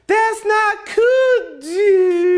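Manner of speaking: very high, slow, hammed-up crying yell; mock wailing shout. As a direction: wail it like a fake meltdown. Pleading rant at tank top guys.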